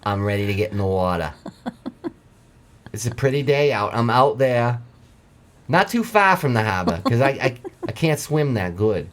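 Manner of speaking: lightly voiced Mainer lobsterman telling his tale; Maine accent. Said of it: "from a Mainer perspective".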